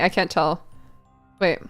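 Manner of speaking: normal talking voice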